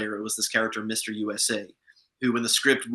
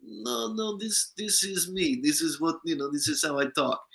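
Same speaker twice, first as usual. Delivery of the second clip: imitated Moldovan accent, pitched higher; 5 semitones higher, 4 dB quieter than usual. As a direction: mimic a Moldovan friend insisting it's his real voice, starting high and settling. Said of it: "who's a Moldovan".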